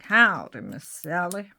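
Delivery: badly faked American greeting, punched first word; hammed-up American howdy; poor American accent. [name] greets Miss Sally, poor American accent. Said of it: "my really poor American accent".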